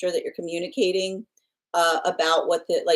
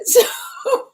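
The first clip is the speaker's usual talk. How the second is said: breathy laugh; 5 dB louder than usual. Real, not acted. Still laughing at the news.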